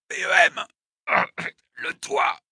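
strained, breath-starved answers; pained gasping. Acted. Wounded attacker answering his interrogator, gasping in pain. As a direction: gasp out each word in pain.

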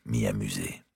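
ordinary delivery

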